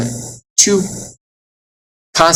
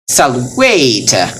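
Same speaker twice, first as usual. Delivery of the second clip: "salvete" with a drawn-out, stressed "ve"; second-to-last syllable stressed. Shown as a demonstration. Teacher showing Latin accent rule, stress on "ve". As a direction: lean on the "ve" in "salvete". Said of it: "on the second to last".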